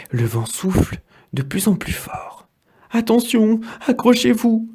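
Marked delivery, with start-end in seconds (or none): 2.9-4.7 high, urgent call to hold on; warning cry. Acted. The apple tree cries to its apples to hold on. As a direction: warn them over the wind. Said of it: "leur crie le pommier".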